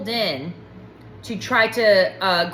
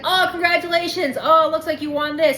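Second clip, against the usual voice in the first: salesman cheer; 7 semitones higher than usual. Retold or imitated. Imitating salesman announcing prize.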